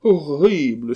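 high, loud exclamation; horrified cry. Acted. Abbé reacting to the tale, loud horrified cry. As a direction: cry out in theatrical horror. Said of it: "s'écria l'abbé".

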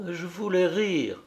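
painfully. A narrator's reading. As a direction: force the words out with effort. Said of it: "prononça péniblement".

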